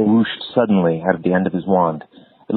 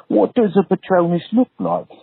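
put-on high, curious boy's question; 11 semitones higher than usual. A narrator's reading. Harry asks Lupin about the Patronus, curious and high. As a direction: voice Harry asking eagerly, pitched up. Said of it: "said Harry curiously".

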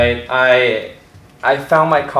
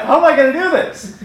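mock panic; 11 semitones higher than usual. Teen self panicking over audition.

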